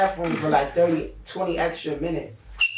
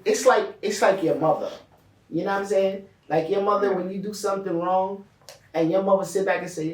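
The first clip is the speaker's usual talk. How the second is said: ordinary talk, nothing marked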